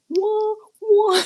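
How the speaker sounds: high, playful mock "whoa". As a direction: imitate Americans going whoa, high and loud. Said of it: "But Americans are like".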